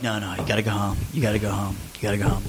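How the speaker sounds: low, soft, talking himself down; quiet self-talk. Retold or imitated. Calming himself down in the car, low and quiet. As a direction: mutter it low to yourself.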